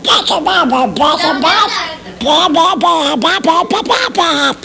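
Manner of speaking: high, choppy scat-like popping; nonsense puppet babble. Acted. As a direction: pop out syllables in a very high cartoon voice. Nonsense syllables in puppet impressions.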